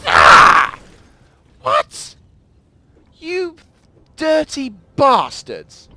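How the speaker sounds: high, loud shrieks of shock and anger; outraged disbelief. Real, not acted. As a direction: yell at the traitors in disbelief. Sudden betrayal in the game.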